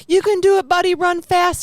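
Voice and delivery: high, loud cheering-on of an animal; retold yelling. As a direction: re-enact urgently yelling encouragement from the car. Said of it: "the whole time I'm yelling".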